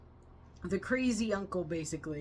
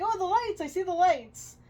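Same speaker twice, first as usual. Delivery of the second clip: high-pitched, louder cry of discovery; mock excited exclamation; 10 semitones higher, 5 dB louder than usual. Acting out someone seeing the lights, mock excitement. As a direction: play up the delight, voice jumping up.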